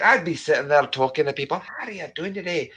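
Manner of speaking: lively put-on Scottish voice; Scottish accent. Streamer disclaiming any talent, mock Scottish accent. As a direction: protest in broad Scottish. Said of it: "your Scottish accent".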